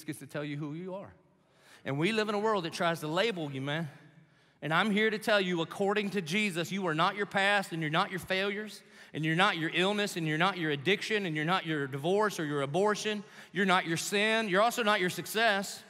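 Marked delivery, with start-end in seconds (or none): 4.6-15.8 rising preacher's fervor, slowing on the call; preaching at full force. Acted. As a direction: preach it louder and louder to the crowd. Sermon builds to full force.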